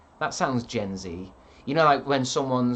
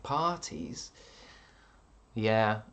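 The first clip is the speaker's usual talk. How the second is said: ordinary talk, nothing marked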